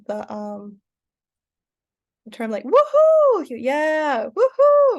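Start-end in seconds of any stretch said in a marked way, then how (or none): 2.6-5.0 high, loud whoops and a yeah; over-the-top celebratory shouts; excited cheering. Shown as a demonstration. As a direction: hype yourself up like at a party. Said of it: "I'm feeling so excited".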